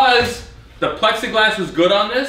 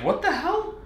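shocked outburst; 10 semitones higher, 7 dB quieter than usual. Retold or imitated. Shocked at the wrong button panel.

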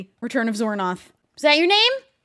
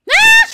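startled scream; 16 dB louder than usual. Real, not acted. Jump scare.

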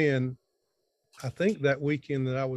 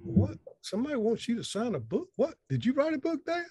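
higher, incredulous kid voices, mock surprise; imitating his kids; 9 semitones higher than usual. Father mimics his kids doubting his fame, mock disbelief. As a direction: playfully mimic his kids' baffled questions.

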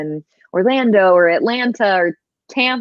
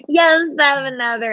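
put-on mom's Southern accent; 7 semitones higher than usual. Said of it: "I'm talking like my mom again".

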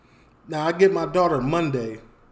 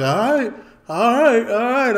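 neighbor backing off; 6 semitones higher, 6 dB louder than usual. Retold or imitated. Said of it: "so he was like".

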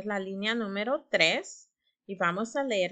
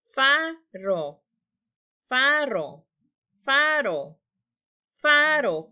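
"faro" said over and over, each take louder, higher and falling; repeated word; 4 semitones higher, 5 dB louder, much slower than usual. Shown as a demonstration. Teacher drilling "faro" to young readers, building. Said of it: "¿Cuál es la palabra?".

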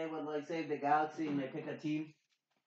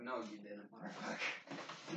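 muttered retort; 5 semitones lower, 11 dB quieter than usual. Retold or imitated. Retorting to bully in movie.

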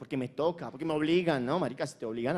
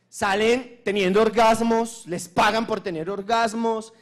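excited; 5 semitones higher, 5 dB louder, slower than usual. Real, not acted. Excited claim about pay.

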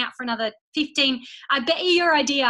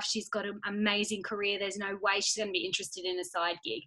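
hushed, lower self-talk of doubt; quiet nagging inner voice; 4 semitones lower, 6 dB quieter than usual. Retold or imitated. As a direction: talk yourself out of it under your breath. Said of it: "Your head will go".

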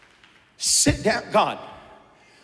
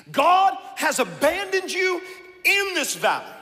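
rising cry; 10 semitones higher than usual. Acted. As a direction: fling the last lie out high. Climax of the lies.

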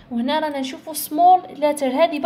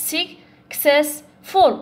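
successful растянуто по слогам очень медленно; медленно раздели successful на слоги; slow syllable split; 2 semitones higher, 4 dB louder, much slower than usual. Shown as a demonstration. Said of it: "ثلاثة syllables".

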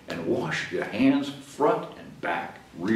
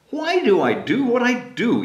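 Carl asking the rabbit; 10 semitones higher, 4 dB louder than usual. Acted. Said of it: "he asked her".

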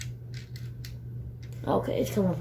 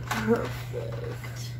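hushed villain whisper; evil whisper; 3 semitones higher, 7 dB quieter than usual. Pretend. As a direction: breathe it like a villain. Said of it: "I sound like some evil person".

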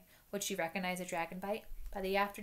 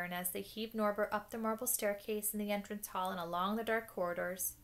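ordinary delivery

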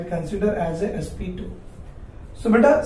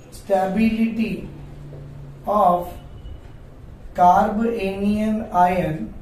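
slow, word by word with pauses; slow dictation; much slower than usual. Shown as a demonstration. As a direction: spell the point out for notes. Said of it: "So we can say one more point here".

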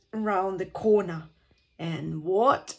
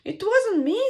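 put-on high, louder young Molly; Molly's denial; 11 semitones higher, 5 dB louder than usual. A narrator's reading. Molly denies she did it, high loud protest. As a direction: pitch it well up, protesting as Molly. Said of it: "said Molly".

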